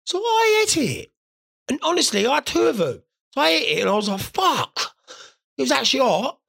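high, excited retelling; squeaky disbelief; high-pitched disbelief. Retold or imitated. Reliving spicy food.

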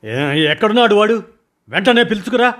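high, loud order; urgent shout. Acted. Boss shouts at his man to fetch the stranger. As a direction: yell for him to be brought now.

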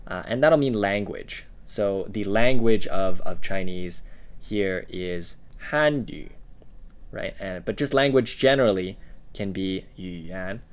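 ordinary talk, nothing marked

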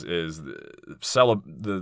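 ordinary talk

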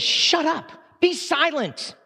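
sharp high command to the demon; commanding Jesus. Acted. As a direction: order the demon out with force.

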